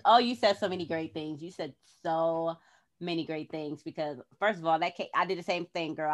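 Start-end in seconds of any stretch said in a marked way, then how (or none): none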